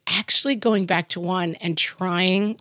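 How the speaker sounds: ordinary delivery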